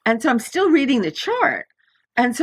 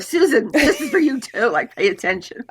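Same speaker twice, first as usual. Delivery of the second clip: guides nudging; 3 semitones higher than usual. Retold or imitated. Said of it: "my guides will sort of hit me".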